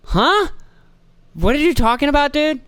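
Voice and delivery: baffled by a dumb point, pitch shooting up; baffled disbelief. Retold or imitated. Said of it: "And you're like".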